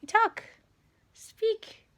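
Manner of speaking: coaxing the cat. Real, not acted.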